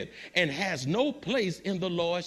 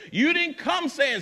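high, loud preaching cry; preaching shout; 8 semitones higher, 4 dB louder than usual. Real, not acted. Preacher scolds the congregation with a loud cry. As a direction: ring it out from the pulpit.

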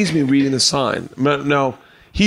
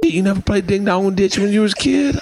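higher, mock-surprised buddy; Antonio surprised; 7 semitones higher than usual. Antonio amazed he never played ding dong ditch. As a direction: lightly act out his disbelief. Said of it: "he's like".

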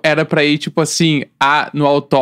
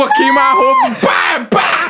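very high excited cry; excited high yell; slower than usual. Real, not acted. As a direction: squeal the bang noises. Excited high gun noises.

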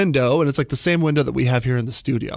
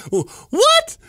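shocked; 4 dB louder than usual. Real, not acted. Reacting to the alien threat.

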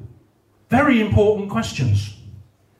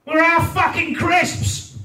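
working-class mum shouting for Tom; bawling working-class mum; 12 semitones higher, much slower than usual. Retold or imitated. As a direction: yell up the stairs at Tom. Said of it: "you probably hear things like".

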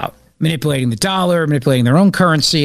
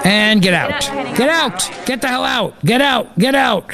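higher-pitched mock order to leave; mock press dismissal; 6 semitones higher than usual. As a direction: shoo the reporters out, repeating it in bursts. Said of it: "Biden instructing the press to leave".

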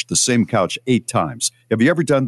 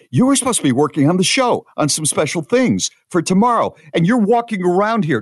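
higher, fast exasperated nagging; frantic complaint; 10 semitones higher than usual. Retold or imitated. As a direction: vent the panic at her. Frantic search retold to partner.

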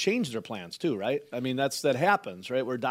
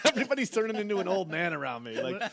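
laughing; 3 semitones higher, faster than usual. Real, not acted. Mocking old man excitement, laughing.

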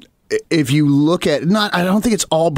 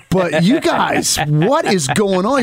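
slow, higher mock disbelief; baffled bandmates; 4 semitones higher, much slower than usual. Bandmates reacting to his sudden country talk, mock-baffled. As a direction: drag it out, imitating bewildered friends. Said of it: "our bandmates are like".

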